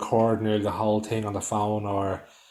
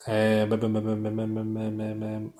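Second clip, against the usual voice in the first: monotone string of ums, fading softer; gone blank; 4 dB quieter than usual. Real, not acted. Speaker who lost his train of thought, blankly stalling. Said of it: "I'm gone blank".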